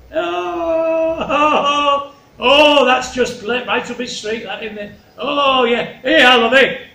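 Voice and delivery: high, loud cooing oohs; gleeful delight. Acted. The king loves the flattery, gleeful delight.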